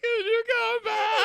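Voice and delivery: high, loud and slow begging; pitiful plea. Retold or imitated. Ex texting Mike to return, pleading. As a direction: draw it out like a sad puppy. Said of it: "text me like".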